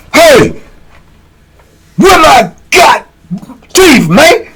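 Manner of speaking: high, toothless old inmate outburst, played for laughs; snapping in anger. Inmate demanding who took his cup, snapping angrily. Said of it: "he, he snapped".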